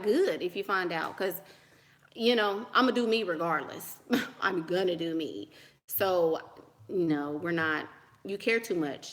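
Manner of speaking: ordinary talk, nothing marked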